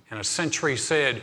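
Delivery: ordinary talk, nothing marked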